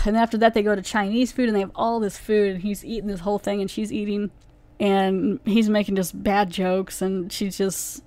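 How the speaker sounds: normal talking voice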